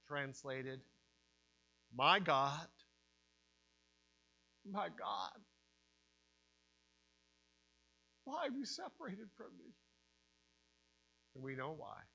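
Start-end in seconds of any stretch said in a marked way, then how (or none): 1.9-9.7 anguished cry. Acted. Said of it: "I think the cry".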